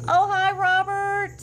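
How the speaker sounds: high, drawn-out hello; excited greeting. Real, not acted. Host greeting a viewer who just joined, high and excited. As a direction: sing out a delighted high hello.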